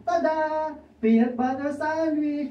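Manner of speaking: high, slow showman's reveal; proud reveal. Acted. Juan grandly reveals his sandwich to Pedro, high and slow. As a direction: unveil it grandly.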